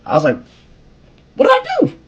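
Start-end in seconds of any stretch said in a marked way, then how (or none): 1.3-2.0 very high, squeaky kid's protest, imitated. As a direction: plead innocence in a comic falsetto. Kid protests being sent out.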